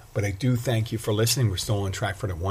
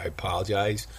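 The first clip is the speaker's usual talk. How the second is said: usual speaking voice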